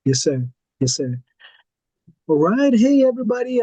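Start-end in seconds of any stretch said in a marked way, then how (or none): 2.3-3.6 bright, loud hello to everyone; loud greeting. Real, not acted. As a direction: call out to the room to open. Host greets the whole audience.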